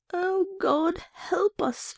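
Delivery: high, pleading mock moan. A narrator's reading. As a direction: moan it like false pity, pitched high. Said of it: "she moaned".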